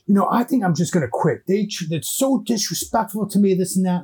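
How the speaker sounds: put-on young woman venting. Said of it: "She's telling her friend".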